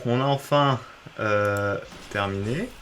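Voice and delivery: usual speaking voice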